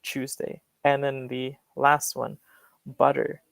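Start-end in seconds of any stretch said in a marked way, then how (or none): 0.0-0.6 "Tuesday" with a soft chu at the start; quiet British "Tuesday", chu sound. Shown as a demonstration. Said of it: "that's standard".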